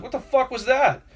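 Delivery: high-pitched incredulous outburst; outraged disbelief. Retold or imitated. Moviegoer reacting to guards falling down, outraged disbelief. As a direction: demand it in disbelief, pitch jumping up.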